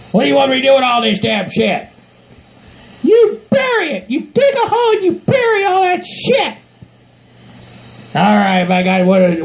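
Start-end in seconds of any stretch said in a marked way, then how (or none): 3.0-6.6 high falsetto scolding voice; bossy partner. Acted. Bride telling groom to bury the manure, bossy. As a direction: lay down the law in a high put-on voice.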